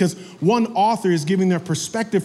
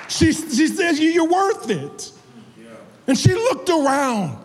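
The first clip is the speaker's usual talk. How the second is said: high-pitched, driving sermon delivery; impassioned preaching; 9 semitones higher than usual. Real, not acted. Preacher explaining what Mary feared without Jesus, impassioned preaching. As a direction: preach it in a sustained high register.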